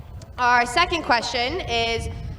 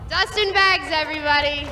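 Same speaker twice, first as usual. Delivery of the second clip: much higher, louder host introduction; host call-out; 5 semitones higher, 5 dB louder than usual. Real, not acted. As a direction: call the guest out to the crowd. Host calls out guest's name.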